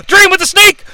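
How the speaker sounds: high, loud play-by-play call; excited call. Real, not acted. Calling the sneak attack to his co-host, excited call.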